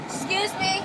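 loud high call. Real, not acted. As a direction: shout to get attention. Calling out to smoker.

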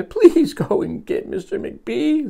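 high, drawn-out plea for help; pleading. Acted. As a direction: beg the lion to fetch someone. Injured librarian pleads for help.

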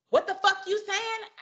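high, indignant challenge; mock outraged. Customer snaps at cashier, in a high indignant voice. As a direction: imitate a rude customer's shrill demand.

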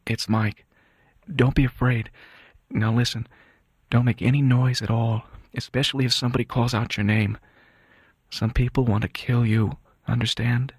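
calm him while warning him, in short phrases; hushed reassurance. Acted. Son reassures wounded father at bedside.

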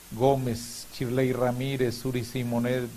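ordinary talk

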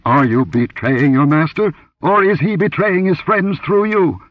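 furious priest. Acted. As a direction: threaten her like a striking cobra. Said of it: "his voice hissed like a cobra".

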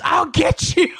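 hammed-up squeal of a threat; retold scream. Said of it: "and he just screams".

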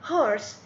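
"horse." with a clearly breathed h at the start; clear h sound. Shown as a demonstration. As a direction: make sure the h in "horse." is heard. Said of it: "قلت هو".

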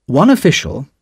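plain reading voice

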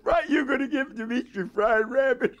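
high-pitched mock outrage; imitated incredulity. Wife questioning husband about rabbit for Dimitri, incredulous. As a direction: mimic a wife aghast at the menu. Wife's shock at the menu, retold.

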